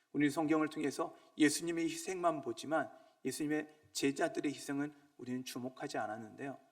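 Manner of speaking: ordinary talk, nothing marked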